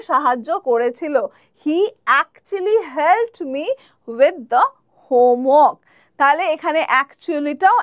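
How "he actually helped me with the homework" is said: very slow, clear classroom read; slow, careful teacher example; slow example sentence. Shown as a demonstration. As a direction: spell it out slowly for learners. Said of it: "example sentence".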